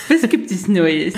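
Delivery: asked through a laugh. Real, not acted. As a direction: laugh while asking again. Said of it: "Das ist eine Scheißfrage".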